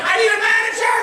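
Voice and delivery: high, loud call for help; flustered young cashier. Retold or imitated. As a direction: imitate an overwhelmed kid calling out. Flustered kid calls for help.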